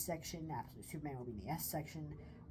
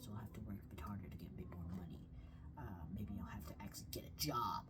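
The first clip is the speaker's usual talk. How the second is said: whispered aside, low and hushed; whispering; 9 semitones lower, 6 dB quieter than usual. Real, not acted. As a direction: breathe it as a secret aside. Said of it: "whispered aside".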